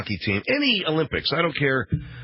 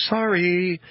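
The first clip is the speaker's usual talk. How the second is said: mock apology; 6 semitones higher than usual. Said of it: "to say".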